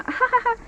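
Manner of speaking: high mock giggle; imitated laugh. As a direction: fake a short high laugh. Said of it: "when our friend laugh and".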